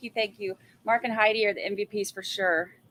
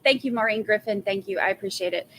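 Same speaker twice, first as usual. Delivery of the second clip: usual speaking voice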